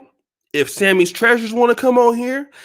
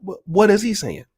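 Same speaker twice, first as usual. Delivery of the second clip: normal talking voice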